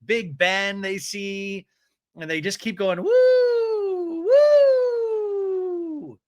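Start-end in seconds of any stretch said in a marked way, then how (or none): 3.0-6.2 very high, loud, long whoops; mock whooping. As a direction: keep whooping like thrilled tourists. Mimics their continual whooping.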